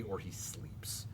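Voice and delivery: sneering whispered aside. Acted. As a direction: hiss the jab under your breath. Muttered aside about Polonius.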